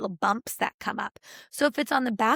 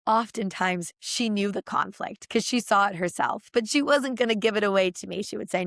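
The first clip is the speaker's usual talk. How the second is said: ordinary delivery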